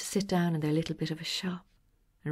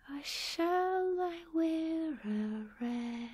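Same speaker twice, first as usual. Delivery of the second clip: slow dreamy question; 10 semitones higher, much slower than usual. Acted.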